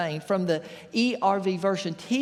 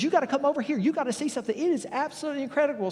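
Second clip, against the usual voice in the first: higher, eager call to his buddy; excited farmer; 7 semitones higher, faster than usual. Retold or imitated. Farmer calling his buddy over, eager and keyed up. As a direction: sell it like you can't believe it. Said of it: "He is so excited".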